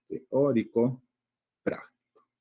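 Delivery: "teórico" and "práctico" set apart by a pause; each word of "teórico práctico" given its own stress; separated words. Shown as a demonstration. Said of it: "sus sílabas tónicas y sus sílabas átonas".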